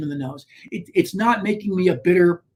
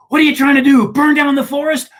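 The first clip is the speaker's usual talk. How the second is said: higher, louder mock rebuke; imitating a scolding; 6 semitones higher, 5 dB louder than usual. Principal scolding the boy in front of teammates, loud and higher. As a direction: ream the kid out, retelling it. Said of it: "He reamed me a new one".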